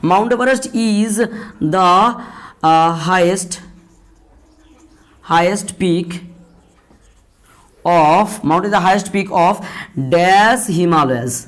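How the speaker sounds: words drawn out slowly while writing them on the board; spaced-out classroom dictation. Shown as a demonstration. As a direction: dictate each word slowly, pausing between them.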